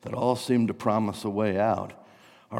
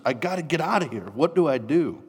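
high, urgent and hurried; louder, panicky self-talk; acted panic; 5 semitones higher, 3 dB louder than usual. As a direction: blurt it out, desperate to escape. Acting a trapped person's panic.

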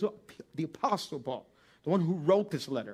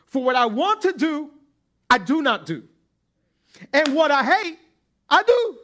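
rising, louder cry of inner struggle; Paul's anguish; 9 semitones higher, 10 dB louder than usual. Acted. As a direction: build it up into a loud, pained confession. Preacher voicing Paul's struggle.

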